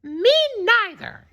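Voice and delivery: put-on very high, squeaky baby kangaroo; tiny kangaroo voice. A narrator's reading. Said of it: "kangaroo in her pouch said".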